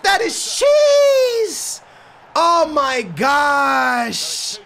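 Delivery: very high, slow outraged yell; outraged shout. Real, not acted. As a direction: drawl out the outrage. Outrage at the call.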